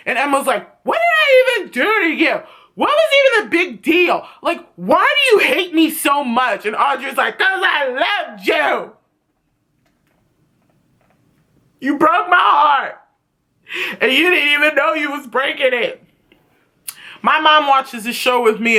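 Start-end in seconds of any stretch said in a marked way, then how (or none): 0.8-6.7 high, indignant mock plea; exasperated pleading. Emma argues with Audrey about their falling-out, mock exasperated pleading. 7.4-16.0 mock heartbroken confession. Acted confession in the quarrel.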